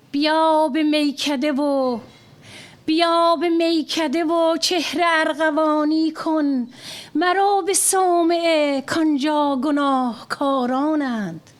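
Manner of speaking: fast, sustained verse recital; declaimed Persian verse; Farsi poetry recitation. Acted. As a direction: recite the couplet to an audience.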